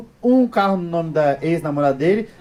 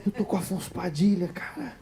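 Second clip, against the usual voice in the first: awed hush; 13 dB quieter than usual. Retold or imitated. Fan staring in awe.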